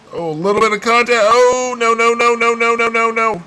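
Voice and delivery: high frantic pleading cry; alarmed as the car slides; panicked no no no. Real, not acted. Contact with another car.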